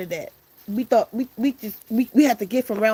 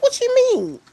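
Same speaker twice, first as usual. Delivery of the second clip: squeaky, much higher shocked question; mock shocked squeal; shocked friends; 6 dB louder, slower than usual. Friends shocked at roach plan.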